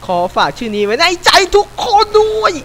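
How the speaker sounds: 2 a high, loud, hammed-up sign-off; 3 proclaim it like a showman; 4 high, loud mock farewell speech; 1 mock grand announcement.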